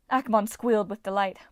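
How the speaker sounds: ordinary delivery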